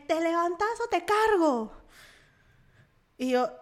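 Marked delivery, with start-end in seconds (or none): 0.1-1.7 raised, slowed threatening yell; gritos imitados. Retold or imitated. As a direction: act out his ultimatum, louder and higher. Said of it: "así unos gritos". (ultimátum a gritos, imitado, lento y agudo)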